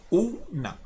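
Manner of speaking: "una" split as u, pause, na; paused between syllables. Shown as a demonstration. Teacher showing students the syllables, "una" with a pause inside. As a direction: leave a small gap inside "una". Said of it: "con esa pausa".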